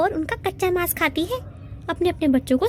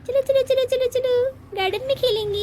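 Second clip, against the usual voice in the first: put-on excited child; 7 semitones higher, faster than usual.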